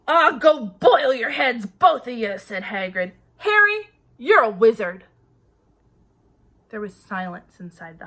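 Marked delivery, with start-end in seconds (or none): 0.1-5.0 loud, dismissive then proud reveal; gruff giant Hagrid. A narrator's reading. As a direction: brush them off, then announce it.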